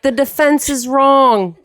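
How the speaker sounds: slow, high-pitched movie line with a lightly put-on New York accent. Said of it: "everybody was like".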